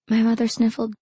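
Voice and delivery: plain reading voice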